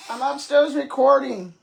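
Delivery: loud call to another room; yelling across the room. Real, not acted. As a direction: shout it up from upstairs. Calling to someone downstairs.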